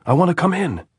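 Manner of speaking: high, loud, urgent plea; shouted plea. A narrator's reading. Andres begging the sentries not to fire, shouting. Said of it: "Andres shouted".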